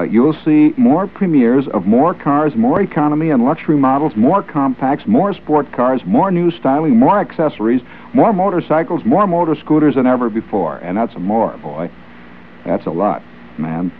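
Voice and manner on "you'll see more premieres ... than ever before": high, building announcer list; mock-grand advertising copy; ad read. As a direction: pitch the car show like a barker. Reading auto show copy.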